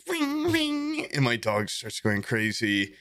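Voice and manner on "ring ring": very high, sung-out doorbell ring; imitated doorbell. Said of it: "my doorbell just started, like".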